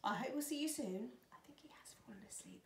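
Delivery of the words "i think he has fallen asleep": quick, very soft whisper; hushed whisper. Real, not acted. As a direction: whisper so as not to wake him. Speaking beside someone fallen asleep.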